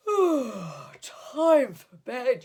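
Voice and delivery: slow, stretched yawn through the words. A narrator's reading. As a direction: yawn it out sleepily. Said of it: "yawned Jefferson Bear".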